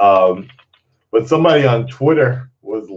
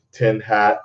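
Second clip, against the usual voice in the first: normal talking voice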